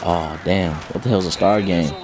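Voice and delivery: low, soft, frightened aside; soft, low, scared aside to a friend; scared murmur. Retold or imitated. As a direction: mutter it so the gang can't hear. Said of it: "So I'm like".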